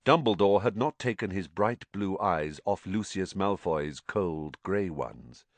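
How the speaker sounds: usual speaking voice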